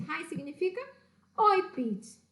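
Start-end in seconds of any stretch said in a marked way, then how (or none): none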